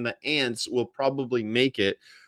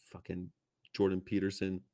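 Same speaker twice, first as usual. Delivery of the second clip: normal talking voice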